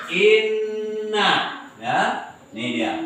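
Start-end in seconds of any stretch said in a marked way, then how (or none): 0.1-1.6 INNA with a long held double n; doubled n. Shown as a demonstration. Teacher shows the tasydid sign, "inna" with doubled n. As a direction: hold the n in "inna" before opening to na. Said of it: "tasdid dibaca double".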